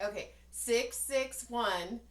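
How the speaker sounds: slow, deliberate reading of digits; slow dictation. Retold or imitated. Slowly dictating a phone number.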